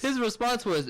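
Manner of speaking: ordinary talk, nothing marked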